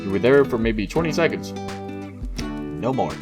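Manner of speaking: ordinary delivery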